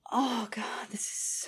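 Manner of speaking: soft unvoiced "Oh, God"; hushed aroused whisper; whispered gasp. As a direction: let it slip out as a whisper.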